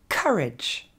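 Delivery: COURAGE as "KUH-redge", first syllable stressed; short falling "redge" after a stressed KUH in COURAGE; correct English pronunciation. Shown as a demonstration.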